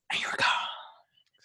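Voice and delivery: slow, soft theatrical whisper; vanishing whisper. Pretend. Improviser escaping fan's accent question, slow theatrical whisper. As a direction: whisper it like disappearing into smoke.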